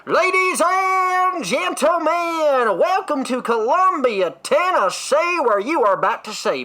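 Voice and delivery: drawn-out, high booming announcer voice; rodeo announcer. Retold or imitated. Cowboy-hat host hyping the rodeo crowd, slow booming announcer. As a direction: stretch every word like a hype announcer. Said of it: "grabs the microphone ... hype the crowd up".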